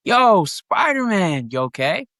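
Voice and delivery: high, slow call of Yo, Spider-Man; quoting his line. Retold or imitated. Actor reciting his first movie line, high and drawn out. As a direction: sing out the line like calling to a friend. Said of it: "the first line was".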